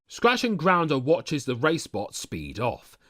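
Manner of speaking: ordinary delivery, nothing marked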